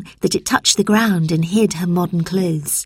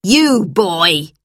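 abrupt teacher's shout; shouted summons; 4 dB louder than usual. A narrator's reading. Teacher singles out Robert, shouted. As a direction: call out the pupil sternly. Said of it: "shouted Missus Bleak".

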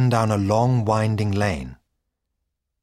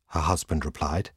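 plain reading voice

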